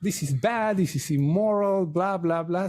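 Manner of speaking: lightly voiced mom telling her son off; mildly mock-stern mother lecture; scolding mom. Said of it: "and she goes".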